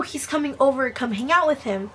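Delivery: ordinary delivery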